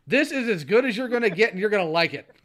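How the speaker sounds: loud, high playful outburst; mock scolding. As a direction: lay down the law in a mock shout. Mock scolding reply to a viewer.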